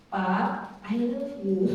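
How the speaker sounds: low, gentle "I love you" to calm an angry husband; sweetly disarm him; soothing. Pretend. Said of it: "kalau suami lagi marah-marah". (lembut menenangkan suami yang marah)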